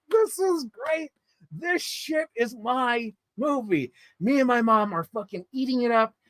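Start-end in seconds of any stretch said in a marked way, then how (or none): 0.1-3.9 high, slow words through laughter; giggling delight at the movie; laughing. Real, not acted. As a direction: laugh as you speak. Laughing while retelling her delight.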